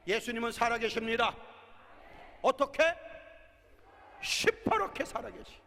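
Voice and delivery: loud rising preacher's blessing; full-force preaching. Acted. Pastor proclaiming to the congregation, building to a shout.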